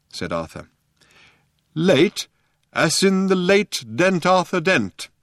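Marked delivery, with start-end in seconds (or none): 1.7-5.1 put-on slow, higher, stern old man. A narrator's reading. Old man threatening Arthur with mock sternness, slow. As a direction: lay the threat on slowly. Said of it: "said the old man sternly".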